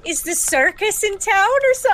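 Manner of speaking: lightly Austrian tourist; amused tourist asks about the circus, lightly Austrian accent. Pretend. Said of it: "I'm doing an Austrian accent".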